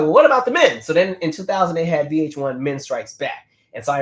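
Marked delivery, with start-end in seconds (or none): 0.0-0.8 high, loud mock-indignant demand; indignant folks. Fans asking after the men, mock-indignant. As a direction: pipe up loudly as the overlooked fans. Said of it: "folks were like".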